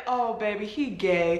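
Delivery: soft, knowing deadpan; quiet knowing tattoo guy; deadpan verdict. Retold or imitated. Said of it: "looked dead at her and said".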